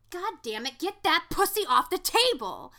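loud, high exasperated order; shouted scolding. Retold or imitated. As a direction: yell it across the room as an acted movie line. Acts out character scolding at table.

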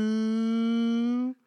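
high, drawn-out impressed ooh; reacting to a fierce tweet with a thrilled ooh. Real, not acted. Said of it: "And I read that and just went".

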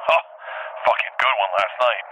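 ordinary talk

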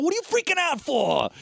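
high-pitched mock yell; retold yelling. Imitating a husband yelling at his panicking wife, exasperated. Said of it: "he's just yelling at her".

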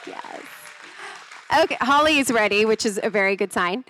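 ordinary talk

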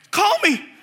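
high-pitched mock plea to mom; urgent pleading. Son urges mom to call him in, high mock pleading. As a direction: beg in a high, comic voice.

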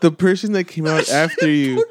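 laughing hard while talking; words squeezed out through laughter. Real, not acted. Laughing while telling the story.

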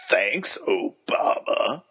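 slow, grave political ad narrator; political attack ad voice. Shown as a demonstration. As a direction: read it like a smear campaign ad. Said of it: "political ad voice ever".

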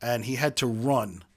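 normal talking voice

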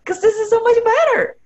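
high, loud, thrilled gushing; squealing that it's so much better; excited gush. Retold or imitated. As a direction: gush like you've just found something better. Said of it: "you were like".

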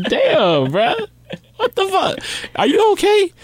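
high-pitched shocked concern; mock alarm. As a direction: act out a startled friend, voice climbing high. Acting shock at drunk friend's rampage.

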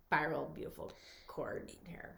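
soft whispered admiration; breathy whisper. Real, not acted. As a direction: whisper it dreamily. Dreamy praise of curls.

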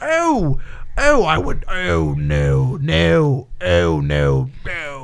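scandalized dandy, overdone; pearl-clutching founding father, hammed-up; Thomas Jefferson impression. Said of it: "That's my Thomas Jefferson impression".

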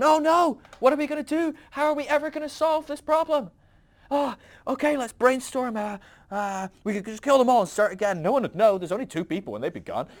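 high, loud mock desperation; hammed-up frantic brainstorming; group panic. Trinity acted out facing the problem, comic panic.

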